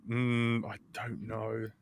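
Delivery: soft, hesitant retold reply; reluctant murmur. Retelling a doubtful answer to a request for help, murmuring. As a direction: trail down quietly, as if unsure.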